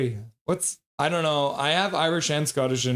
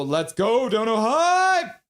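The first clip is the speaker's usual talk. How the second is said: loud, rising, stretched-out cheer; donation hype; 8 semitones higher, 5 dB louder, much slower than usual. Real, not acted. Host celebrating Major's donation, hyped up. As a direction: build to a high drawn-out whoop.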